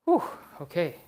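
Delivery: ordinary talk, nothing marked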